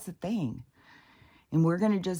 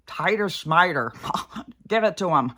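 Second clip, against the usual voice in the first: mocking dismissal; 2 semitones higher, 6 dB louder than usual. Mimicking vets who skip testing, mocking dismissal. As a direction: wave it away in a loud mock voice. Mocks careless vets skipping the test.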